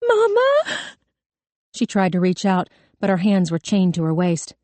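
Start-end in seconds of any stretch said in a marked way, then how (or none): 0.1-0.8 put-on high, desperate girl's wail. A narrator's reading. Distraught girl wails to her mother in court, wailing. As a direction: cry out in a high, thin child's voice. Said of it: "Kelly began to wail".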